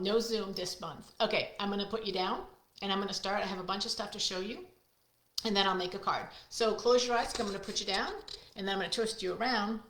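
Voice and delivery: normal talking voice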